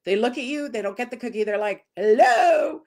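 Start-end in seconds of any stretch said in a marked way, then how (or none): 2.0-2.8 loud, high bark-like call; demanding dog. Retold or imitated. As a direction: mimic a dog barking out for its cookie. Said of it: "they give you a bark".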